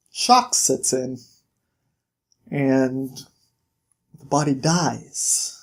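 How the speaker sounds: low, slow, halting delivery; grave bad news. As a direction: break the news gently, with long pauses. Bigsby gently breaks terrible news.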